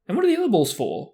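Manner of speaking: fast, high and loud asking; eager question. Acted. Young fan hurrying to ask Harry, fast and high. Colin pestering.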